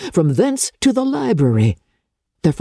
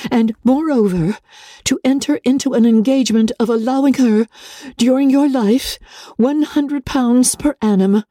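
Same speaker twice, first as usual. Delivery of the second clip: slightly higher, measured letter reading; letter read aloud; 7 semitones higher than usual. Acted. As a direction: read the uncle's letter steadily.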